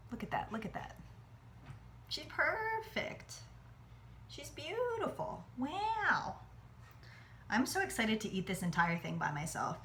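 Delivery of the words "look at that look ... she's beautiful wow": high, soft, slow cooing; doting coo. Real, not acted. Doting on flan.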